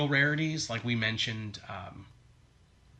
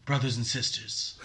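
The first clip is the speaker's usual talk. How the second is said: imitated crowd address. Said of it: "talking to the crowd".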